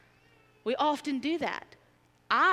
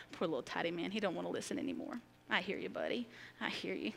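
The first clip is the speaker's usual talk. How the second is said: much softer, gentle comforting; 4 semitones lower, 10 dB quieter than usual. Real, not acted. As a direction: murmur kindly to a fussy little one. Soothing a fussing child.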